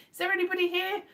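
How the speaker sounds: high, searching call into the void; mock seance call. Streamer checks whether any viewers remain, mock seance call. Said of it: "It's like doing a seance".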